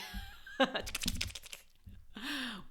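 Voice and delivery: high, loud burst of laughter; real laugh. Real, not acted. Host joking to listeners about the Gemini energy, laughing out loud. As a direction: burst out laughing, pitched well up.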